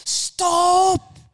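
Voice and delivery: high, loud drawn-out yell of alarm; panicked passengers' shout, acted out; sudden shout. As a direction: yell it out like friends warning the driver. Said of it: "så roper de bare".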